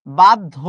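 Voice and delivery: "বাধ্য" said bad-dho, loud first syllable; pronunciation demo. Shown as a demonstration. Teacher modelling for students, "বাধ্য" as firm bad-dho. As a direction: punch "বাধ্য" as bad-dho, doubled consonant. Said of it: "এই যে".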